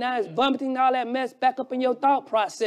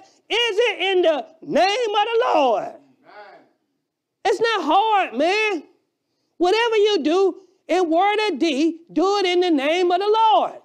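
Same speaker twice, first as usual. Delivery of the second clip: preaching at full force; 6 semitones higher than usual. Acted. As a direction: preach it hard to the congregation. Preacher builds again.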